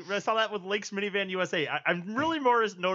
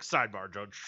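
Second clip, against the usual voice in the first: much lower, quieter lawyer voice; mock lawyer; 10 semitones lower, 4 dB quieter than usual. Friend imitating the lawyer approaching the judge, low murmur. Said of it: "be like".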